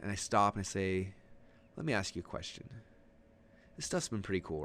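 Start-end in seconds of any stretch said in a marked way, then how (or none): 1.8-4.6 soft, understated lead-in; low-key transition. Shown as a demonstration. Coach turning to webinar audience before the offer, soft low-key question. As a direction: ease off and ask it quietly. Demonstrates calm transition into pitch.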